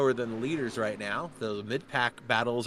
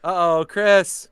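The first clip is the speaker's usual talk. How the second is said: loud, drawn-out, higher-pitched uh-oh; alarmed call-out; 5 semitones higher, 13 dB louder, slower than usual. Real, not acted. Commentator spotting trouble for driver on track, loud drawn-out alarm. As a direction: call out the trouble loudly and slowly.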